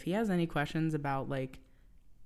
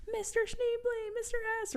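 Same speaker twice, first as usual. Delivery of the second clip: put-on sweet pleading kids; kids calling; 4 dB quieter than usual. Host voicing the kids calling the teacher, childlike. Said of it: "they're all like".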